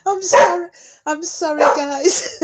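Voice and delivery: very high, giggly apology; real laughing fit. Real, not acted. Apologising to the stream, overcome with laughter. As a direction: squeak out an apology through laughter.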